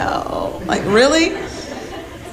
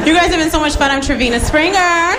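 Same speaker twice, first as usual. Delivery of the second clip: stage sign-off; 7 semitones higher, faster than usual. Real, not acted. Comic signing off to crowd.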